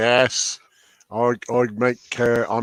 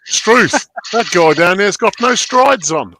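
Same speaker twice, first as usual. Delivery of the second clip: put-on hoger en luider nep-Australisch accent; Australian accent; 5 semitones higher, 6 dB louder than usual. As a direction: gooi er een overdreven Australisch accent tegenaan. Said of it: "I thought you said Australian".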